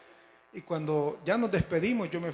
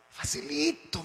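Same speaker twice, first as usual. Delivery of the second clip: high, lilting "easy-peasy" tone; breezy reassurance; 11 semitones higher, slower than usual. Retold or imitated. Old church members advise the eager newcomer, breezy. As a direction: toss it off as if it were nothing.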